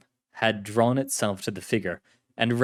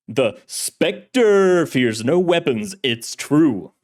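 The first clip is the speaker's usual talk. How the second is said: booming, theatrical boast with a stretched-out name; hero's bellow; 4 semitones higher, 7 dB louder, much slower than usual. Acted. Shadowy hero boasts to the director, grand bellow. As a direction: bellow it like a radio-serial hero. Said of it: "he bellowed".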